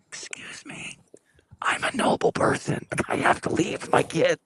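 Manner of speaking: hushed, slow excuse-me; mock discreet whisper. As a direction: whisper an excuse while sneaking out. Mock discreet exit past audience.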